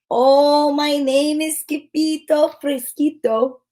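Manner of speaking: put-on slow, lightly sung Spanish accent. The kitty boy sings his name in a Spanish accent. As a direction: croon it like a Spanish hero. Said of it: "using his very best Spanish accent".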